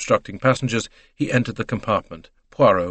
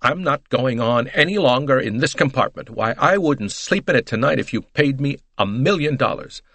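high, emphatic American matron; worked-up lady making a dramatic protest; indignant American lady; 4 semitones higher than usual. Acted. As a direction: refuse outright, indignantly. Mrs Hubbard refuses her compartment.

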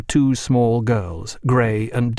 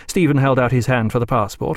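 usual speaking voice

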